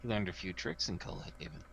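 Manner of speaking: soft in-character mutter. Acted. Player voices Pearson reacting to Jarek's ghost trick, muttered softly. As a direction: mutter it under your breath. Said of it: "as Pearson mutters".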